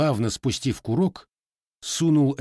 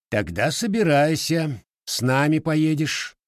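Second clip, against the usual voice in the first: order; 6 semitones higher than usual. A narrator's reading. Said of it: "приказал ротмистр".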